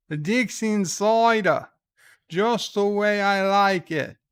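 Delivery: hammed-up Aussie, Cider as soy-da. Streamer redoing the cider slogan for a viewer, mock Aussie accent. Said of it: "I can do it in Aussie voice".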